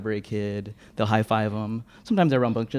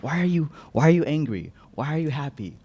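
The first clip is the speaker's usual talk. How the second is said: higher-pitched questioning grown-up; exasperated grown-up; 4 semitones higher than usual. Retold or imitated. Library grown-ups questioning kids about feelings, exasperated. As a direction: imitate an adult quizzing a child, pitched up. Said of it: "library grown-ups are like".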